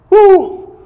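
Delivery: strained high whoop from the effort; loud high whoop. Real, not acted. Cheering after the plank.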